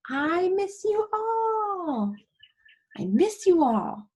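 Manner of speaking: high, slow, loud heartfelt cry; loud emphatic exclamation. Shown as a demonstration. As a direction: exclaim it so they feel it. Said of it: "How about exclamation point?".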